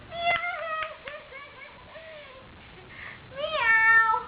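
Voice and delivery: put-on giggly cat noise for the baby; playful meow. Parent imitates a cat.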